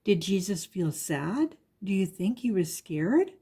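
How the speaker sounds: ordinary delivery, nothing marked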